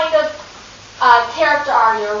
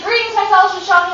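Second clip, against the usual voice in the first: Sacagawea's greeting; 6 semitones higher than usual. Retold or imitated. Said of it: "When you hear her say".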